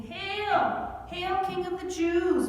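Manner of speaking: high, loud sneering salute; mocking hail. Soldiers mock Jesus in the purple robe with sneering hails. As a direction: jeer it at him. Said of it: "making fun of him".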